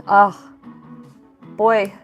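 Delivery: usual speaking voice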